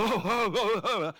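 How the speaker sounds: loud, high startled gasps. Acted. Moses startled by the voice in the bush, loud high gasps. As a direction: jump back in fright.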